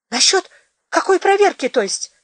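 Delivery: high, wary question; a suspicious, tense query. Acted. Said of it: "настороженно застыл Федот".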